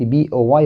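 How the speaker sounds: "بي او واي" as separate letter names; spelling letter by letter. Shown as a demonstration. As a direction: spell "بي او واي" as bee, oh, why. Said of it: "تهجيت الكلمة حرف حرف".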